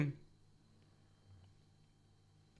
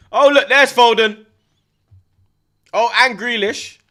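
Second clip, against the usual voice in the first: loud, slow, pitched-up excitement; excited; 11 semitones higher, 14 dB louder, slower than usual. Real, not acted. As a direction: call out the names as they appear. Excited spotting subs.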